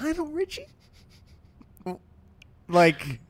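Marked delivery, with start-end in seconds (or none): 0.0-0.7 incredulous. Real, not acted. Disbelief at scam celebrity choice.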